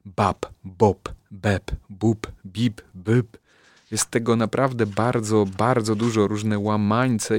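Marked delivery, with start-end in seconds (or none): 0.0-3.4 "Bab, bob, beb, bub, bib, byb" mit kräftigem B gesprochen; B-Silbenübung. Shown as a demonstration. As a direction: jedes B deutlich aus den Lippen gesprengt. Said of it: "mam tutaj ćwiczenia głoski B".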